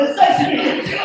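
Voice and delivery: loud, high, frantic politeness; panicked greeting. Retold or imitated. Boyfriend grabs the father's thumb, frantic greeting. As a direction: shout the pleasantry in a fluster.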